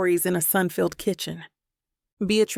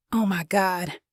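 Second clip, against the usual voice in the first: wheezing laugh. A narrator's reading. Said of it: "I wheeze".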